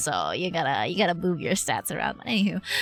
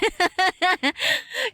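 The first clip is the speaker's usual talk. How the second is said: laughing; 11 semitones higher than usual. Real, not acted. Laughs at bad artifact.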